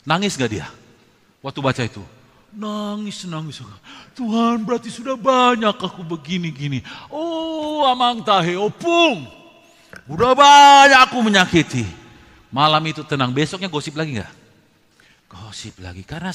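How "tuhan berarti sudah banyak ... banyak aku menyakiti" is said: a hint of an anguished sinner's prayer, slow exclamation; mildly acted remorse, swelling louder; repentant gossiper. Gossiper praying to God after reading the stories, remorseful.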